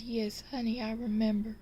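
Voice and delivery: put-on woman's voice; fond wife. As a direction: pitch it up as the touched wife.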